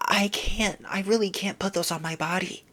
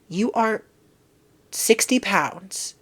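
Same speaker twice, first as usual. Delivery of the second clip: ordinary delivery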